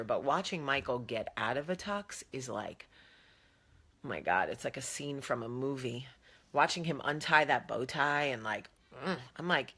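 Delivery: ordinary talk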